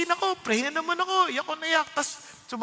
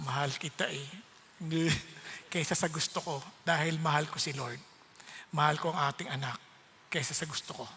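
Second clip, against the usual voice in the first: softer, lower loving words; telling his wife, softer and tender; 6 semitones lower, 8 dB quieter than usual. Retold or imitated. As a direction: say it gently to your wife.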